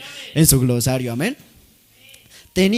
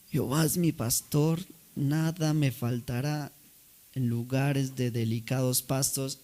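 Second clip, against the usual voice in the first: put-on failing old voice; 4 semitones lower, 5 dB quieter than usual. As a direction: let the voice nearly give out. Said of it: "le fallaba la voz". (voz temblorosa de anciano, suave y lenta)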